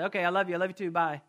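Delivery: quick throwaway I love you, the way you end a call; tossed off like a goodbye, no weight; casual sign-off. Retold or imitated. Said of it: "it's almost like a salutation".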